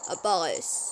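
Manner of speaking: slow mock narrator; dramatic narration. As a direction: narrate it like a mysterious tale.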